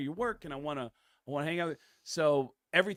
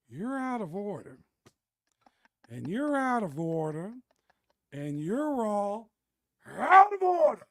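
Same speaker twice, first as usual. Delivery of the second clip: slow, building Pacino rant, ending high and loud; 8 semitones higher, 8 dB louder, slower than usual. Retold or imitated. [name] doing Al Pacino yelling among broken washers and dryers, slow and building. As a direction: drag it out and explode on the last words. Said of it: "me as Al Pacino".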